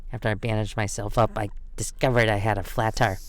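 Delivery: plain reading voice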